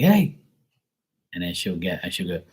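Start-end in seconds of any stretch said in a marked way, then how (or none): 0.0-0.4 calling out. Retold or imitated. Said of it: "Sometimes I'll be like".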